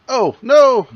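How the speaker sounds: sudden high yelp of dismay; startled cry. Real, not acted. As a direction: blurt it out, caught off guard. GM hits wrong button.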